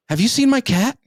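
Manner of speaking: high, anxious imitation of the girlfriend; worried girlfriend. Boyfriend imitating his date asking about her cat, high and anxious.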